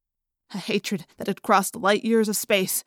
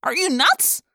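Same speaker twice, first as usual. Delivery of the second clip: high incredulous cry; cried out; 7 semitones higher, 3 dB louder, slower than usual. A narrator's reading. Marco yelling at Ax, high disbelieving cry. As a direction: shout at him in disbelief. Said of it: "Marco cried".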